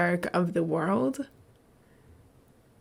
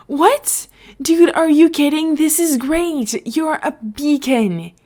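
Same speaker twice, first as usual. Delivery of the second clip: much higher and louder, slowing into delight; thrilled disbelief; 8 semitones higher, 5 dB louder, slower than usual. Acted. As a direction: gush at your friend like it's the best news ever. Beef thrilled at Etho's power.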